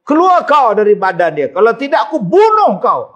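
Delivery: hammed-up exorcist threat; mock exorcist yell. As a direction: imitate a harsh healer bossing the spirit. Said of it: "Jangan duk tengking dia".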